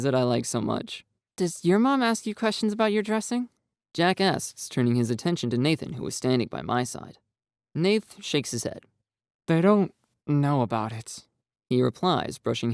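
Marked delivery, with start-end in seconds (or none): 1.4-3.5 high, anxious character voices; fretful boys' voices. A narrator's reading. Jack voiced in story. 9.5-11.3 a hint of a shy boy; lightly voiced Nathan, subdued. A narrator's reading.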